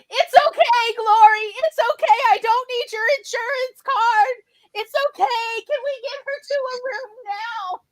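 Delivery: pushing everyone aside to get her seen right now; high frantic yelling. Retold or imitated. Pam yells across the file room, high and frantic. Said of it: "Pam is yelling".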